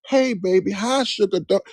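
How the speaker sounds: a lightly voiced sweet welcoming lady. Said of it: "She was talking like a ... she was so sweet".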